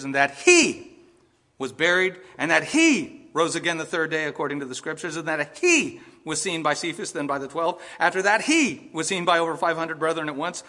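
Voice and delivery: scripture reading with "Christ" and every "he" punched; stress on CHRIST and HE. Shown as a demonstration. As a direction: ring out "Christ" and each "he" like a bell, then pause. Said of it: "I am gonna make an emphasis".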